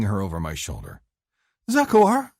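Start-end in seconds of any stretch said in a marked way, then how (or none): 1.7-2.3 high, loud startled cry of his name; sputtering. Acted. Woman lifted over his shoulder, sputtering in surprise. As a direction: caught off guard, flustered. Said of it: "she sputters".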